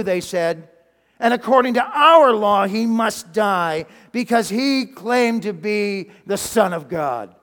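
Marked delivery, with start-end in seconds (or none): 1.2-7.4 loud, drawn-out indictment; forceful accusation. Acted. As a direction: press the charge hard, stretching the words. Leaders demand death from Pilate.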